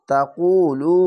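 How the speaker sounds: drawn-out chanted reading of তাকুলু, vowels held long; slow Quran recitation. Shown as a demonstration. Teacher demonstrating tajweed to students, slow chanted recitation. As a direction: recite তাকুলু slowly, stretching each vowel. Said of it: "এক আলিফ টেনে পড়বেন".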